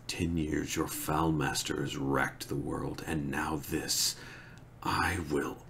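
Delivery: a grieving man whispering threats at the traitor; soft menacing whisper. Acted. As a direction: hiss it quietly like a drawn blade. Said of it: "Lews Therin said softly".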